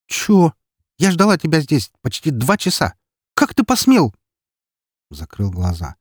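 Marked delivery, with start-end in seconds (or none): 0.1-4.1 high-pitched, loud outrage; angry bark. A narrator's reading. Эри berates the man who kept her waiting, barking angrily. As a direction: snap indignantly, climbing higher and louder. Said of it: "рявкнула Эри".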